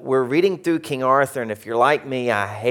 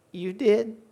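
high, small voice of disbelief; mock meek surprise, pitched way up; stunned question; 9 semitones higher, 4 dB quieter than usual. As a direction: squeak it out, caught out. Acts his stunned reply to teacher.

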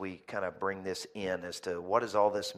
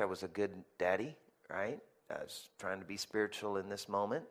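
ordinary talk, nothing marked

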